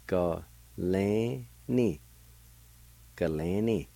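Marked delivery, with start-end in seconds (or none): 0.1-2.0 slow, separated Ga lan ni; syllable by syllable. Shown as a demonstration. Teacher repeating the word for "ear", slowly by syllable. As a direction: stretch each syllable with a small break. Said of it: "it's pronounced like this".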